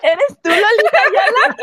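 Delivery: teasing question through laughter; laughing tease. Real, not acted. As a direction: laugh while teasing her. Teasing after voice crack.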